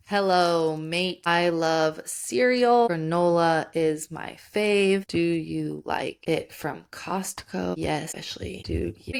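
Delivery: slow, low, drawn-out words; slow, deliberate sample dialogue; dictating while typing. Acted. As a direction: stretch each word as if typing it out. Voices made-up dialogue while typing it.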